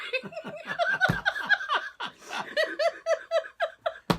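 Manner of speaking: high sustained laughter; can't stop laughing; a real high-pitched laughing fit; long laughing fit. Real, not acted.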